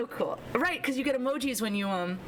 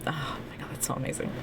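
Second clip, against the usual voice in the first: awed whisper; 8 semitones lower, 4 dB quieter, faster than usual. Real, not acted. Awed over character option.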